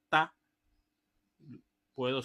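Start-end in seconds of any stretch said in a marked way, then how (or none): 0.1-0.3 "ta" cut short; one quick staccato "ta"; short eighth note. Shown as a demonstration. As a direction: say a single abrupt "ta". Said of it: "Si yo hago".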